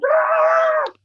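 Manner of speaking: high, loud imitated shriek of horror; mock scream. Husband retelling his wife seeing the dog hit, high mock scream. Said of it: "She was like".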